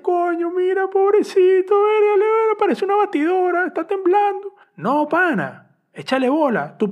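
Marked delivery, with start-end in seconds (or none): 0.0-4.5 much higher, louder overdone pitying voice; mock pity. Mocking pitying friends reacting to his tremor, high exaggerated pity. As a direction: ham up the syrupy pity, pitched way up. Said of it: "Van a decirte".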